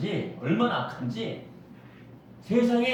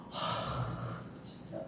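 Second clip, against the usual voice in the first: exasperated sigh; 16 dB quieter, slower than usual. Acted.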